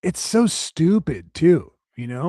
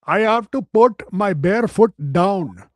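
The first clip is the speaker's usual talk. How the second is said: mock Indian accent; 5 semitones higher, slower than usual. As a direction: protest like a scandalised employee in a hammed-up Indian accent. Employee protesting in put-on accent.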